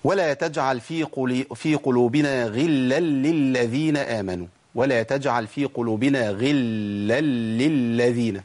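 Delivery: slow recitation holding each lam of غلا للذين; every lam sounded. Shown as a demonstration. Teacher recites to count them, every lam sounded. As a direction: stretch غلا للذين so all doubled lams show. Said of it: "شوفوا أنا جبت لامات قد إيه؟".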